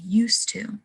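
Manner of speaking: "used to" run together as "yoostoo"; assimilated "used to". Shown as a demonstration. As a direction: say "used to" with voiceless s and one t. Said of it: "the used is being influenced by".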